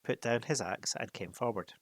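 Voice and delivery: plain reading voice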